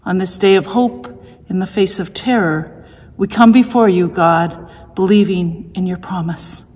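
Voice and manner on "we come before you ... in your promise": ordinary delivery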